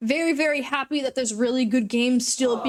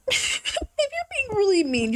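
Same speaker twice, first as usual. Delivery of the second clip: laughing; 6 semitones higher, slower than usual. Shown as a demonstration.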